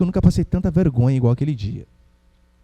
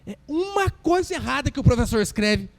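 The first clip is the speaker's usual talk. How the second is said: ordinary talk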